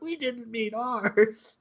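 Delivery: high, drawn-out comic complaint; mock protest. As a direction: imitate a beaten thug's dragged-out objection. Retold beaten ruffians protesting.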